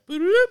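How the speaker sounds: very high, loud rising bloop; imitated phone chirp. Son recalling his mom's call, imitated high chirp. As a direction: mimic the push-to-talk beep. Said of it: "My mom would be like".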